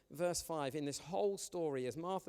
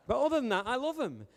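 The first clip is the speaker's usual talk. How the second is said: usual speaking voice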